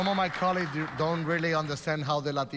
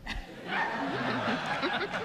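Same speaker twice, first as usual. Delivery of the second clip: own laugh; 6 semitones higher than usual. Real, not acted. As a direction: chuckle at yourself. Laughs about his accent.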